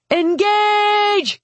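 very high, drawn-out battle cry; screamed command. A narrator's reading. Mage setting off the spheres as the enemy closes, screamed command. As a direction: scream the order to attack. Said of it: "Philip screamed".

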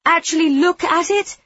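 high loud insistent cry; exclaiming. A narrator's reading. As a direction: demand he really looks. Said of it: "Demeter exclaims".

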